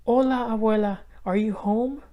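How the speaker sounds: put-on high, calling child's voice; girl calling out. A narrator's reading. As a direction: call out to grandma as a little girl. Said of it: "she called".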